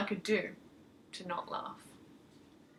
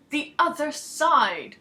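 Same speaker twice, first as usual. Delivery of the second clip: exasperated crone; 6 semitones higher, 6 dB louder than usual. Acted. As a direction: spell it out to a fool.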